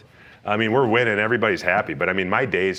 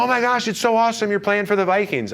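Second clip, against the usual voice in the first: gushing fan voice; 12 semitones higher than usual. Retold or imitated. People gushing to him.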